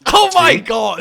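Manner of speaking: loud, high, drawn-out exclamation; exasperated outburst. Real, not acted. Arguing with friends over a saying, exasperated. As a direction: cry out in disbelief at friends.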